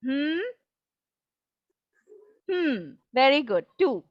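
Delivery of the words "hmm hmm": soft separate hums, spaced apart; humming. Shown as a demonstration. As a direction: hum one beat per syllable. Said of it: "hum and tell me".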